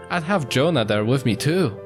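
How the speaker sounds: usual speaking voice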